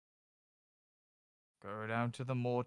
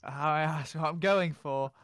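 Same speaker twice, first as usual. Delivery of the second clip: loud insistence; 4 semitones higher, 7 dB louder than usual. Real, not acted. GM insisting loudly, slowly.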